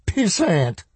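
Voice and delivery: high-pitched, rising toward hysteria; woman edging toward hysteria. A narrator's reading. Woman insisting help will come, near hysterical. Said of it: "the sound edging toward hysteria".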